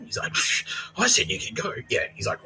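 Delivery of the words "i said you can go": raised-pitch telling-off; scolding housemaster. Retold or imitated. Housemaster confronting the returning student, scolding. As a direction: play the stern housemaster.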